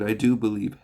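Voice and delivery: loud, urgent cry of faith; exclaimed. A narrator's reading. A desperate father exclaims his faith to Jesus, loud. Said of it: "the boy's father exclaimed".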